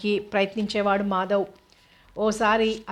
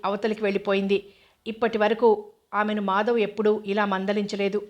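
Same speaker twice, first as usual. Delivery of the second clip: usual speaking voice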